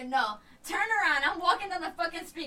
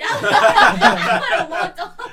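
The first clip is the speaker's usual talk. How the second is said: slow, loud, through laughter; slow loud laughing line; 5 semitones higher, 12 dB louder, much slower than usual. Real, not acted. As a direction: push the words out between laughs. Laughing while telling.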